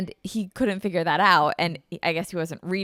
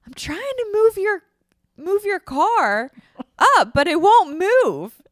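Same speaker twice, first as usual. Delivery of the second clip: flustered dad; 11 semitones higher, 9 dB louder, slower than usual. Retold or imitated. Dad's flustered reply retold.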